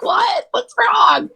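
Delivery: high mock panic; imitating worried husband. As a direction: parody a fussing husband. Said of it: "He's like".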